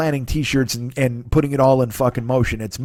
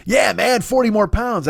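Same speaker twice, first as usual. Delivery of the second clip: upbeat and higher, taking it in stride; mock-positive pep; cheery acceptance; 6 semitones higher, slower than usual. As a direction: brush it off with a bright "yeah, man". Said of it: "And instead of going".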